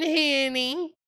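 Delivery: ordinary delivery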